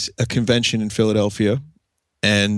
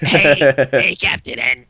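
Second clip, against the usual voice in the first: Mega Man voice; 3 semitones higher, 4 dB louder, slower than usual. Retold or imitated. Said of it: "I'll sound like Mega Man from Captain N".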